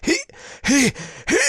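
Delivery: high strained gasping stammer; dying stammer. A narrator's reading. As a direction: choke out the words while dying. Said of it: "he said".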